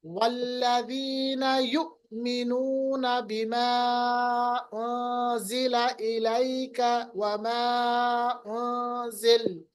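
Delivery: slow, chanted tilawat; Quranic recitation. Shown as a demonstration. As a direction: recite the verse slowly, phrase by phrase. Recites verse. (धीमी तिलावत)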